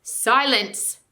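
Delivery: loud commanding bark; thundering command. Acted. Calormene lord silencing the crowd, loud. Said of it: "thundered Rishda Tarkaan".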